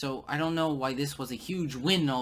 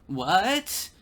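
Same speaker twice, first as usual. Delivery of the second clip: all-caps what; 6 semitones higher, 7 dB louder than usual. Retold or imitated. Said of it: "he puts in all caps".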